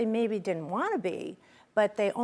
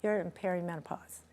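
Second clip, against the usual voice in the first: gentle doctor; 3 semitones lower, 6 dB quieter than usual. Retold or imitated. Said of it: "And he says".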